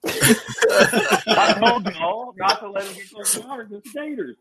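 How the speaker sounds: imitating Kent's Southern warning about gators; Southern accent imitation. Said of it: "like Sam said".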